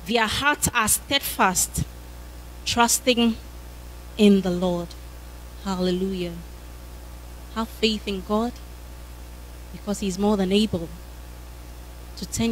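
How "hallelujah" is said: ordinary delivery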